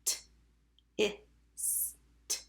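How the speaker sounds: "T, I, S, T" as separate letter sounds; sounded out. Shown as a demonstration. Teacher tapping tist with pupils, sounded out. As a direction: tap out "tist" with gaps between sounds. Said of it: "Tap tist".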